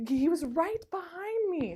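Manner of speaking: high, drawn-out mock protest; retold indignant wail; mad mom. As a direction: imitate an exasperated mother insisting, slowly and high. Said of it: "mad at me".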